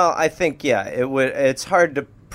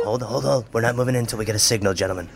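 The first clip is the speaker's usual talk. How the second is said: hushed commanding lawman; 8 semitones lower, much faster than usual. Acted. Lawman orders agents.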